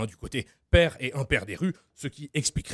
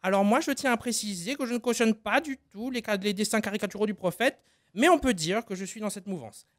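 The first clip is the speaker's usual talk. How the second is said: a raised, halting Taubira; Taubira impression; 7 semitones higher than usual. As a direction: speak high and hesitant, struggling to say it. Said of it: "Christiane Taubira".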